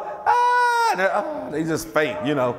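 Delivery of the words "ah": very high sung-out stage holler "Ah"; a mock falsetto singer's cry; imitated high holler. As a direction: belt it like a soul singer on stage. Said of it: "he just holler".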